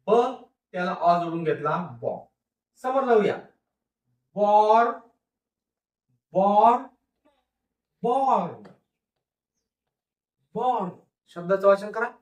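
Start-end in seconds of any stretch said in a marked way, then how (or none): 4.4-10.9 teacher sounding out बॉर्न; sounding out born. Shown as a demonstration. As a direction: blend बॉर्न slowly, the r kept light. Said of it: "आर चा उच्चार एकदम थोडासा नकळत".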